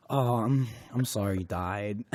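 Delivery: soft, low mock sympathy; apologetic girlfriend. Girlfriend consoling gamer who died in game, soft pitying imitation. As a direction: imitate a girlfriend's meek pity, voice dropped. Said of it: "And then she's like".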